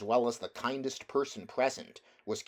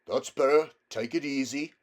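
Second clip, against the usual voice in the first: soothing cabby; 4 semitones higher, 5 dB louder than usual. A narrator's reading. Cabby calming horse.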